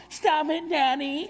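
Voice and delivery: put-on high, loud little girl's plea; a daughter begging her dad to let go; imitating little daughter. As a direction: squeal it like a small girl. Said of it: "till they go".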